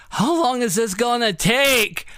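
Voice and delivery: high, slow, exasperated demand; impatient complaint. Retold or imitated. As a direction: drag it out, fed up.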